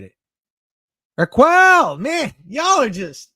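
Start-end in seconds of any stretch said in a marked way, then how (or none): 1.2-3.3 much higher, louder burst of real delight; calling a viewer's name, thrilled; overwhelmed greeting. Real, not acted. Streamer thanking returning chat, high excited call-out.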